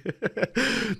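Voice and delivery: soft wheezy giggle; real giggle at himself; stifled laughter. Real, not acted. Streamer apologizing for his bad joke, giggling.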